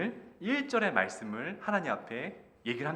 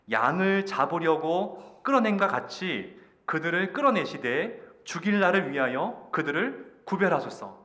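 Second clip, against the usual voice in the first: loud, high, emphatic verse reading; forceful scripture reading; 3 semitones higher, 7 dB louder than usual. Acted. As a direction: proclaim the prophet's plea in measured phrases. Reads verse three aloud alone. (힘차게 선포하는 성경 봉독)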